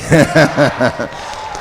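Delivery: loud, high bursting laugh. Real, not acted. Laughing with moderator.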